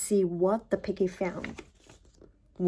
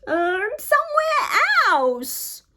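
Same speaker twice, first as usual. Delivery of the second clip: pleading; 11 semitones higher, slower than usual. Acted. Elephant pleads.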